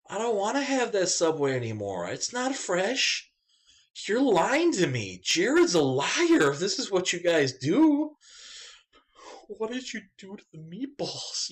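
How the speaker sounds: high, loud, slow, hammed-up rant at Subway; over-the-top mock indignation; mock outraged rant. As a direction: ham up betrayal by a sandwich shop.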